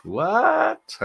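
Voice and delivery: ordinary talk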